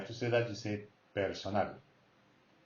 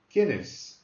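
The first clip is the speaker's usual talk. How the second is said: ¿Quién es? with quién punched loud and falling; quién stressed as a question; 4 semitones higher, 8 dB louder than usual. Shown as a demonstration. Teacher contrasting statement and question, loud stressed quién. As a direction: hit quién hard as a question word. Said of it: "You got an accent".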